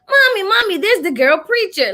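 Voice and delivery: put-on high, excited small-child voice; imitating little twins. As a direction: call out like a kid to mommy. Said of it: "these little twins would be like".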